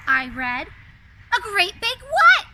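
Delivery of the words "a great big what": surprised Chip. Acted. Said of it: "said Chip".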